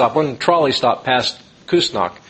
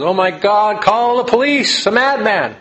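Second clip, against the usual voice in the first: high, louder panicked call for police; a hammed-up frightened librarian; alarmed cry; 8 semitones higher, 4 dB louder than usual. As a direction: shout for help as if a madman is loose.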